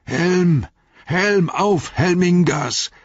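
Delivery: put-on old warrior rallying his men to charge; battle cry. A narrator's reading. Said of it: "rief er".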